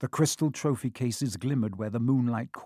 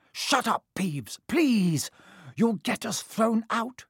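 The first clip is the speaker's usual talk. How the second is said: desperate pleading; 9 semitones higher, slower than usual. A narrator's reading. Pleading with Peeves.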